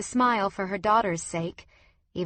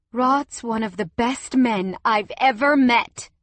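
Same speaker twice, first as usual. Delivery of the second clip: sharp, louder outraged retort; outraged snap; 4 semitones higher, 5 dB louder than usual. A narrator's reading. India snapping at Claudia in outrage over Rod. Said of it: "India snapped in outrage".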